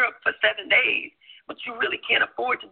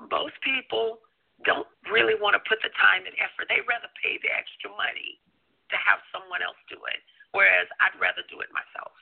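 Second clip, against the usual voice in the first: usual speaking voice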